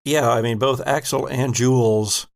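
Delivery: ordinary talk, nothing marked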